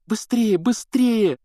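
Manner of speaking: urgent high pleading. A narrator's reading. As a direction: beg them to hurry. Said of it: "взмолилась Гермиона".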